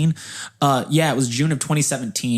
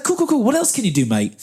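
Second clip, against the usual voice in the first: put-on higher-pitched, amateur New Zealand accent; bad New Zealand accent; 9 semitones higher than usual. Interviewer interrupts an applicant, bad New Zealand accent. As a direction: put on a shaky-quality Kiwi accent. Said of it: "do a very bad New Zealand accent".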